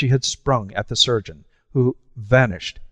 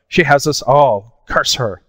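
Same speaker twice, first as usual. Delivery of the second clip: louder, higher panicked curse; angry cry; 4 semitones higher, 6 dB louder than usual. A narrator's reading. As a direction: spit the curse out in fear and rage. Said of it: "he cried".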